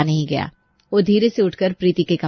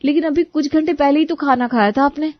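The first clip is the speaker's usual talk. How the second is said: much higher, disbelieving; protesting bride; 7 semitones higher than usual. Acted. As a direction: object politely. Preeti objects.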